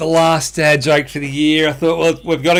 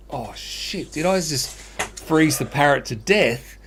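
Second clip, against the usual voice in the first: hushed, dawning dread, lightly acted; mock panic; 7 dB quieter than usual. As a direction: drop the voice as if quietly realising disaster. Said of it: "the man starts to panic".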